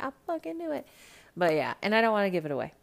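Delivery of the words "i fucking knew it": high-pitched called-it squeal, not loud; exclaimed aloud. Retold or imitated. Host retelling own reaction to twist, high exclamation. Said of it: "I did say that out loud".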